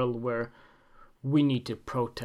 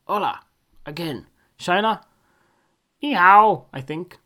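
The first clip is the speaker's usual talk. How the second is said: normal talking voice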